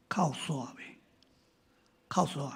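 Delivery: whispered gently, almost no voice; soft whisper. Retold or imitated. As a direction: whisper the question softly to a man who has stopped crying. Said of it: "我著甲问讲". (轻声细语问)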